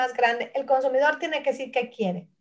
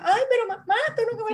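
put-on high pleading little girl; mock childish plea to mom; childish whine; 9 semitones higher than usual. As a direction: whine like a kid denied a treat, pitched up. Voicing her childhood self crying.

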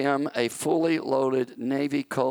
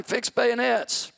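higher, slow yelled command; yelled order; 9 semitones higher, slower than usual. Retold or imitated. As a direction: call it again, this time yelling. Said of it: "So he yelled it the second time".

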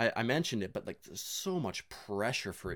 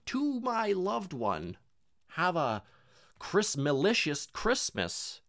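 mock card reading; 7 semitones higher, much slower than usual. Reading dollar store card.

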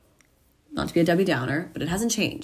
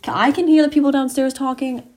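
higher, louder sudden notice; alarmed; 8 semitones higher, 7 dB louder than usual. Real, not acted. Vlogger noticing family talking downstairs, loud and higher. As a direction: blurt it out as the noise hits you.

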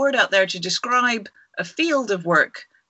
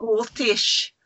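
attempted Scottish accent; 2 semitones higher than usual. Shown as a demonstration. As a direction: echo it in tentative Scottish. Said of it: "You got to speak Scottish".

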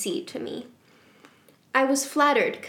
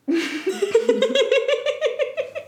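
very high, helpless laugh; squeaky uncontrolled laughter; high giggling fit; 13 semitones higher, 3 dB louder than usual. Real, not acted. Cracks up at garbled adverbs.

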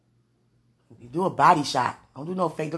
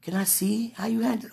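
normal talking voice

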